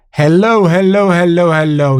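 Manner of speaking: smooth podcast host voice. Shown as a demonstration. Host demoing the podcast voice. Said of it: "this is the podcast voice".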